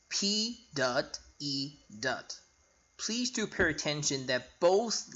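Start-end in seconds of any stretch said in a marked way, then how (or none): 0.1-2.4 letters spelled slowly one by one; slow spelling out. Shown as a demonstration. Teacher spelling slowly for students. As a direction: spell each letter out carefully for the class. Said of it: "Now, P E is spelled".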